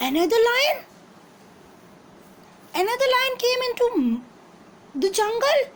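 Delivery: a king outraged at a rival; very angry. Acted. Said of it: "the lion got very angry".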